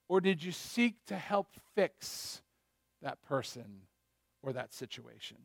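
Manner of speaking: gentle fade. Real, not acted. Softening to gentle help.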